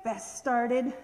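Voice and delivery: ordinary delivery, nothing marked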